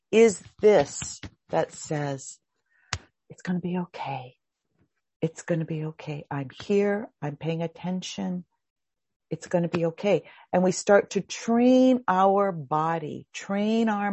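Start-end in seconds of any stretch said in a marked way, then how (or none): 3.3-10.3 soothing inner voice. Shown as a demonstration.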